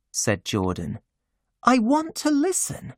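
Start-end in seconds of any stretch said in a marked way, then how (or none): 1.6-2.9 put-on much higher hushed young woman; high, urgent hush; Jordan's voice. A narrator's reading.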